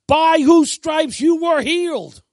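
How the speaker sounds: loud, high, slow declaration; not passive. Shown as a demonstration. Preacher takes hold of God's promise, forcefully. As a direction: proclaim the promise with full force. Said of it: "but not passively".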